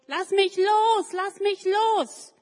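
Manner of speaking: high, loud, slow frightened shriek; terrified little boy yelling to be let go; scared scream. Acted. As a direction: scream to break free. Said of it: "Und der Junge schreit".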